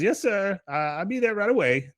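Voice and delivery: high, obedient underling; deferential employee. Retold or imitated. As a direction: answer the boss in a high mock voice. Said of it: "he goes".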